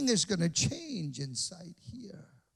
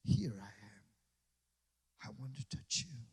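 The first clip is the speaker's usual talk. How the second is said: soft voice of the Spirit; 7 semitones lower, 9 dB quieter than usual. Pretend. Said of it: "He begins to say".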